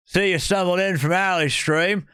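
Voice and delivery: words formed with a motionless top lip; stiff upper lip. Shown as a demonstration. Streamer greeting another stream's viewers, awkwardly stiff-lipped. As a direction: struggle to talk without moving your upper lip. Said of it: "don't move my top lip".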